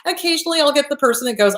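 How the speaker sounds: ordinary delivery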